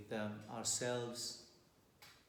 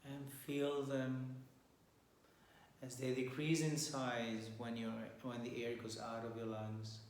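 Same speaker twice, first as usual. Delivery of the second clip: softer, unhurried meditation voice with long pauses; guided meditation; 2 semitones lower, 8 dB quieter than usual. Acted. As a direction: guide listeners calmly into their breath and posture.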